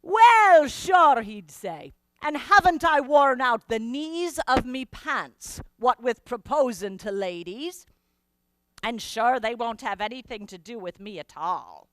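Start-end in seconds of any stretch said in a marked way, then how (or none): 0.0-1.2 loud, high Irish exclamation; cheery Irish brogue, louder; Irish doctor. Retold or imitated. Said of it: "the ship's good Irish doctor". 2.2-11.9 lightly voiced Irish brogue, rueful joke; a hint of an Irish bachelor's self-mockery; Irish doctor. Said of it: "the ship's good Irish doctor".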